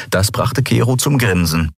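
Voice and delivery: ordinary delivery, nothing marked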